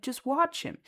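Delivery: mock indignant dad, pitched high; retold yelling. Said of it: "he starts yelling at me".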